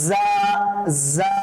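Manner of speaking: জা begun with a long buzzing z. Shown as a demonstration. Teacher models the letter for pupils, জা with buzzing z. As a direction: buzz like a bee into জা. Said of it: "ঠিক সেই রকম ভাবে উচ্চারণ করার চেষ্টা করতে হবে".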